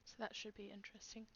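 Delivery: unvoiced teasing whisper. Real, not acted. GM teasing players about the next backstory, whispered. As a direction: breathe it as a sly aside.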